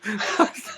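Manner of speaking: short high giggle; quick amused laugh; laughter. Real, not acted. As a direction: chuckle at your own words. Amused by own explanation.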